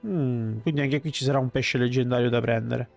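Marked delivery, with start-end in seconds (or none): none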